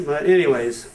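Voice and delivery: ordinary delivery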